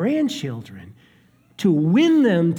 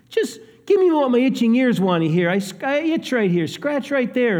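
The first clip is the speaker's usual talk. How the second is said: higher, quick, whiny self-indulgent demand; mock itching ears; 7 semitones higher than usual. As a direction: pester for what you want to hear. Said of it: "It's not because they wanted to believe".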